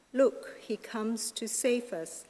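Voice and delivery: usual speaking voice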